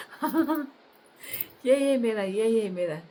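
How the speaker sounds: high, loud, eager yes yes; greedy eager cry. Acted. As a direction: grab at it like a greedy drunk. Greedy drunk grabs expensive bottle.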